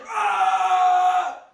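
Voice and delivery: high, loud scream. Retold or imitated. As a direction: let out a huge scream. Screaming in the classroom.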